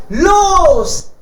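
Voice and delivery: loud high call of los; shouted start. Acted. Animals launching their noise with a loud shout. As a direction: yell go to start the band.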